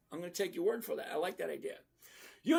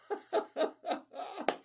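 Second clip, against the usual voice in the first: high laugh; 10 semitones higher than usual. Real, not acted. Laughs at own joke.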